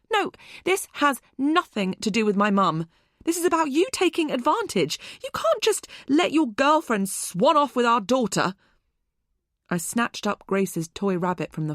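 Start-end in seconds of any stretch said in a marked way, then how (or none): 0.1-8.5 louder, rising, faster in the middle; heated protest. A narrator's reading. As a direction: push back hard at him. Heated argument with ex.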